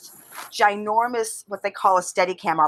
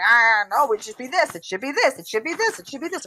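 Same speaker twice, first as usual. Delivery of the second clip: mock squabbling; 5 semitones higher than usual. Director mimicking her team's battle over a fix, mock squabbling. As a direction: imitate an argument, each repeat pitched higher.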